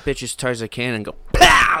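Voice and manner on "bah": sudden yelled bah; shout. Shown as a demonstration. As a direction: yell it out like squeezing hard. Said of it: "like that".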